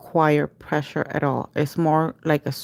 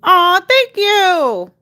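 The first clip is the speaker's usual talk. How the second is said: slow, much higher, louder aw; touched thanks; 14 semitones higher, 8 dB louder, much slower than usual. Real, not acted. Thanking viewer Miss Gina, genuinely touched and gushing. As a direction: melt at the compliment, stretching the aw.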